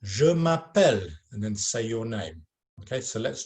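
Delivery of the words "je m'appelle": Je m'appelle said slowly and clearly; slow French demo. Shown as a demonstration. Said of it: "you're going to say".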